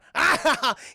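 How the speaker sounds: loud, high jeering cackle; acted laugh at someone's expense; hammed-up guffaw; mocking laugh. Character laughs at Gideon.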